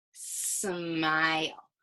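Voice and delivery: "Smile" drawn out slowly, leaning on the sm; slow stretched word. Shown as a demonstration. Teacher models saying "Smile" slowly for the class. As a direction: stretch out "Smile" so the s and m can be heard. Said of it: "Say the word really slowly".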